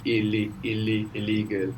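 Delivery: "illi, illi, illegal" with matching ee-like vowels; English "illi, illi, illegal", second vowel a long ee; same English vowel sound. Shown as a demonstration. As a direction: repeat the first syllables, then "illegal" in English. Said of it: "both making the same sound in English".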